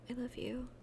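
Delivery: soft unvoiced whisper; a girl whispering her love to Kenny. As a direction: breathe it to him. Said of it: "I whispered".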